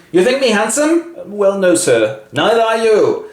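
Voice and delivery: high bantering voices. Acted. Rochester and Jane spar.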